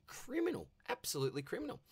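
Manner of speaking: over-the-top mock disgust; mock outrage. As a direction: play it as a joking outrage. Rival lacks a key player.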